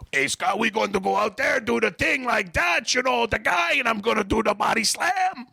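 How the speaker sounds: high-pitched, hammed-up French Canadian accent; Butch, French Canadian accent. Tag partner plans the body slam, caricature French Canadian accent. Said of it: "what a French Canadian is".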